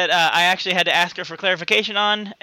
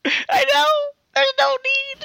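high-pitched mock wail of shame; mock crying. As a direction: whine it like you're about to cry. Pained high crying protest.

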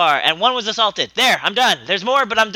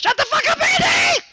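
much higher, shouted punchline; bartender yelling. Acted. Bartender shouting at Andy, high yell. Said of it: "And the bartender says".

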